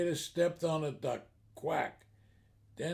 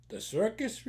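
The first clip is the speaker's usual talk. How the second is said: higher, rising echo question; puzzled disbelief; 6 semitones higher than usual. Acted. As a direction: repeat it as a mildly incredulous question. Duck incredulously repeats the offer.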